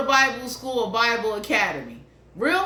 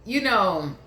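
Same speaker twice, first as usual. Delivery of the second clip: ordinary talk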